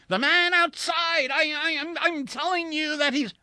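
very high, loud, stammering panic; frantic yelling. A narrator's reading. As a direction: yell desperately to be believed. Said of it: "please keep your voice down".

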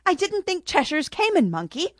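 put-on a young girl's surprised cry; sounding half her age. Girl sees the Cheshire is a monkey, exclaiming childishly. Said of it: "sounding half her age".

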